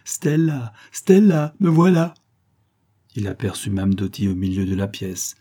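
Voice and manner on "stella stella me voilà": high, loud, happy call; joyful shout. A narrator's reading. Lover bursts in calling for Stella, joyful shout. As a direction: call out with fresh delight. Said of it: "en criant de sa voix joyeuse et fraîche".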